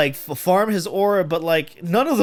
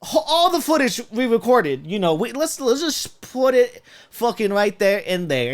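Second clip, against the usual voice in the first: mock editor voice; 7 semitones higher than usual. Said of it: "it's just like".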